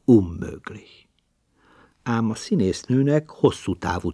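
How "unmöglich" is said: slow, unvoiced growl under the breath; stage mutter of the word unmöglich; low growl. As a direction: mutter it darkly, slowly. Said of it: "morogta anyám is".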